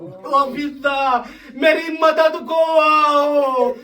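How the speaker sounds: high, drawn-out lamenting call; crying out for help. Retold or imitated. Fatima calls Fizza to her aid, high wailing shout. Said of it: "جناب فاطمہ زہرا آواز دے رہی ہے".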